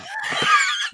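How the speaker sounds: high burst of shrieking laughter; sudden high shrieking laugh. Real, not acted. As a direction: let out a squealing cackle. Laughs at own joke.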